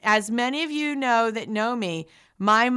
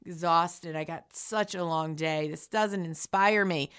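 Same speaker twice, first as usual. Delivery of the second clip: low, soft, deflated grumble of someone who feels not good enough; mock self-doubt; 7 semitones lower, 7 dB quieter than usual. Listener on a tired morning, in a low, soft, defeated sigh. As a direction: sink the voice low and quiet, sighing out the doubts. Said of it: "Some mornings you might go".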